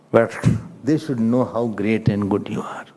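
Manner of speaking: low, soft humble praise; hushed acted tribute; quiet devotion. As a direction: say it reverently with the voice dropped. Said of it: "Lakshman ji was very quiet".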